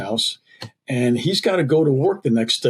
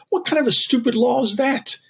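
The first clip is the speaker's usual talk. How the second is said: mock indignant; 11 semitones higher than usual. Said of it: "a little stupid myself".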